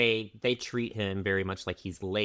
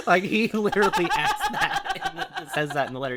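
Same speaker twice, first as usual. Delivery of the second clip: laughing, raised pitch; 9 semitones higher than usual. Real, not acted. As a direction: giggle through the line. Laughing while telling.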